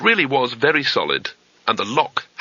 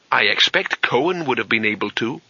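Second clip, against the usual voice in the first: usual speaking voice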